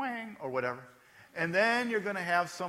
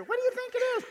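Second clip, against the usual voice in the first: put-on much higher small kid's voice; child voice; faster than usual. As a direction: ask it like a playful little child. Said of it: "your grandkids or your kids come up to you".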